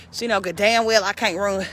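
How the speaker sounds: normal talking voice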